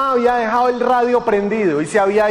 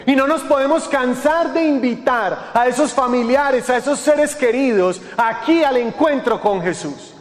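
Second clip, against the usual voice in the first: driving, repetitive exhortation; insistent preacher building through a list; forceful preaching; 4 semitones higher than usual. Acted. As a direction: urge the congregation on, point after point.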